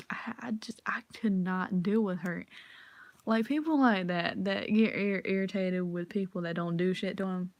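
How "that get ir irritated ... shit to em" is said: normal talking voice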